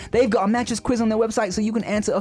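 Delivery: upbeat host-read sponsor pitch. Acted. Podcast host reading a sponsor spot, upbeat ad read. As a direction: sell the mattress like a friendly plug.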